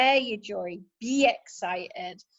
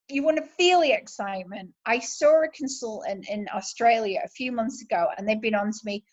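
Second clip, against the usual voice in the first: usual speaking voice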